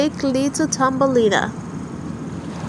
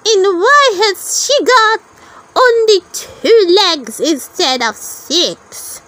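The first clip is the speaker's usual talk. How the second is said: scoffing lady beetle; 7 semitones higher than usual. A narrator's reading. Lady beetles scoffing at the tiny girl, high sneer. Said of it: "they scoffed".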